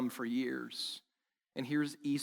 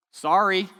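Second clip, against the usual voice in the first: mumbled sorry; 6 semitones higher, 20 dB louder, slower than usual. Retold or imitated. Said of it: "show up and be like".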